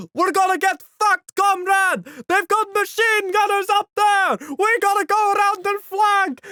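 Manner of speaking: high, loud urgent warning; panicked shouting comrade. Retold or imitated. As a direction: yell the flanking plan under fire. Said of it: "he's just like".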